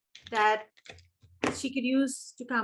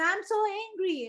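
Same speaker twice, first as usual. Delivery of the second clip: put-on upset little boy; 10 semitones higher than usual. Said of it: "and he said".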